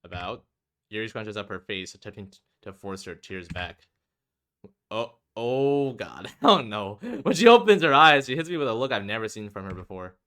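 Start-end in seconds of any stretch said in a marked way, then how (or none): 4.9-7.0 slow dread. Real, not acted. Reaction to Yuri's look.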